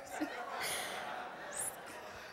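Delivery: short, forced laugh to cover up; nervous laugh. Acted. Nervous excuse about phone cord.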